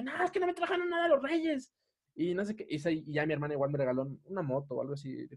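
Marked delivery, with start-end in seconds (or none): 0.0-1.7 high, soft aggrieved whine; upset retold complaint. Upset child complaining to hermana about Reyes, high soft whine. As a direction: imitate a kid complaining, pitched way up. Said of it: "Y yo estaba bien enojado".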